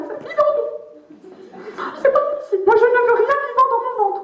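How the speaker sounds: voix de bourgeoise suraiguë et scandalisée; bourgeoise outrée. Retold or imitated. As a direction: jouer la cliente horrifiée, très haut perché. (bourgeoise dégoûtée par les huîtres, voix suraiguë)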